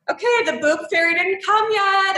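put-on childlike plea. Recalling her seventh-grade self.

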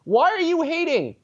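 high-pitched, exasperated question; incredulous challenge. Acted. Challenging people who discriminate, incredulous.

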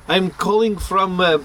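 put-on joking Russian accent, retelling a call; bad Russian accent. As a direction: imitate a caller's Russian accent, badly. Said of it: "He has, like, a Russian accent".

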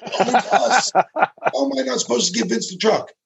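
high, laughing incredulous protest. Real, not acted. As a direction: laugh through the words in disbelief. Laughing while retelling incredulously.

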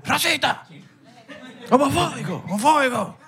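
high, thin cries of accusation; mock outraged accuser. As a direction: imitate an offended heckler in a squeaky pitch. Mimicking people who take jokes as offensive.

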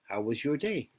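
lightly voiced machine assistant; HAL-like computer voice. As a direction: ask it as a polite computer, understated. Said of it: "sounds kinda like the computer".

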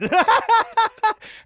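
loud, high mock cackle; imitated son's laugh. Son who missed the joke still laughing, high mock laugh. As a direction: laugh along loudly without getting it. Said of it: "he would just keep laughing".

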